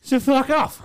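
loud, rising to a high yell; mock telling-off. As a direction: bark it like a bouncer turning people away. Imagined telling off at the door.

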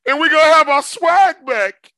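high loud hype line; hyped shout. Acted. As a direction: promise it with swagger. Hyped promise.

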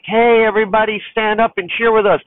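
high, loud pep-squad shout; rally the stands like a cheerleader; bright mock cheer to the crowd; cheerleader call.

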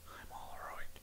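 barely voiced whisper; hushed, breath-only repeat; mock-solemn whisper. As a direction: whisper it like a grim, haunting memory. Hushed repeat of the grim punchline.

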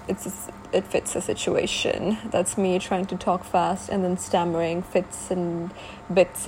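ordinary talk, nothing marked

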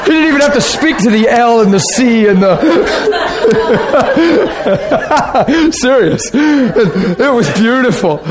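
high, laughing burst of joy. Real, not acted. Preacher laughing about the husband's prayer. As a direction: overcome with laughter at the memory.

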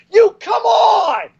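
high, drawn-out disgusted yell; exasperated shout. Retold or imitated. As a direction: groan it out like a fed-up fan. Fans groaning at WWE.

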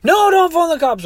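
high mock wail of alarm; sobbing. Crying woman stops driver calling police, imitated wail. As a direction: protest in an exaggerated high whine. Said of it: "she's sobbing and sobbing".

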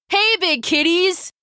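high, taunting call; sudden shout. A narrator's reading. Galen drawing lions off Lydia, shouting. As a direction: call out to grab the beasts' attention. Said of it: "Galen shouted suddenly".

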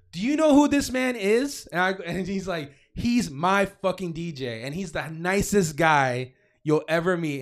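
high, loud acted confrontation; indignant defence. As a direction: imitate a friend loudly standing up for someone. Retells Mike angrily defending him.

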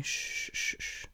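soft breathy shushing; hushing. A narrator's reading. Eijiro gently hushing panicking Katsuki, whispered shushing. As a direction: hush him gently, almost no voice.

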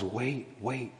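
very soft, low-pitched urging; hushed reassurance. Acted. God telling the exiles to wait, hushed. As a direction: say it low and gently, almost under the breath.

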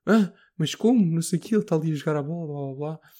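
high, fast, anxious protest; nervous protest. Retold or imitated. Camp monitor protesting nervously to the boss, high and fast. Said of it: "já bué nervoso".

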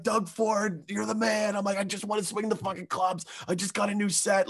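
high, gushing golfer glee; excited. Retold or imitated. Golfer reenacting his thrill at reopened courses, high and gushing. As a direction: gush like a kid getting a treat. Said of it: "Like, I'm so excited".

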